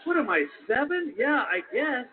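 offended and defensive; indignant. Retold or imitated. Indignant inner reply.

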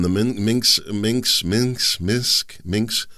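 stumbling repeatedly over one word; real tongue-twisted false starts; tongue-tied. Real, not acted. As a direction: keep retrying the word, tripping over it. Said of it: "tongue-tied there on that one".

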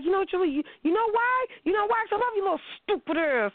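high, half-mocking tough-guy love line; New York guy confessing love. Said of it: "They be like".

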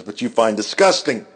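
usual speaking voice

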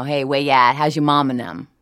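light New Orleans yat accent, easy and chatty; a hint of yat, greeting a neighbour; casual New Orleans yat accent, lightly done. Shown as a demonstration. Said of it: "let me think about something very yat".

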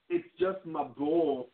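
a wee lad lisping about his ball; Scottish Brave accent. Acted. Host replays the imagined scene in a Scottish kid voice. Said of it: "a Scottish slash Brave accent".

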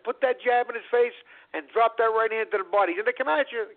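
high-pitched cornerman's instructions; urgent coaching. Retold or imitated. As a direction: urge your fighter on from the corner. Cornerman urging fighter in retold bout.

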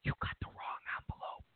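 hushed, fast whisper; whispered aside. Retold or imitated. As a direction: whisper it like a stagehand fixing a mistake. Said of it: "They go".

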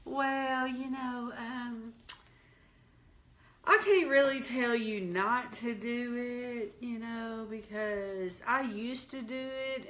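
soft, hesitant mimicry of an evasive preacher; mock a minister hedging, trailing into quiet ums and pauses; imitated noncommittal mumbling sermon; wishy-washy minister. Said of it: "They'll be like this".

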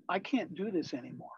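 hushed, understated confession; quiet confession. Retold or imitated. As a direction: drop the volume right down, as if owning up to a bandmate. Retells a hard confession to bandmate.

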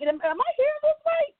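high-pitched, incredulous retold question; disbelieving. Retelling her answer to God's rebuke, high-pitched disbelief. Startled reply to God, retold.

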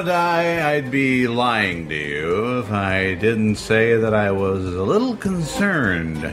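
ordinary delivery

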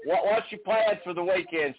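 usual speaking voice

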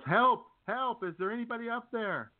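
high, desperate call for help; a climber dangling from a branch shouting for help; yelling for help. Retold or imitated. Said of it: "And he yells".

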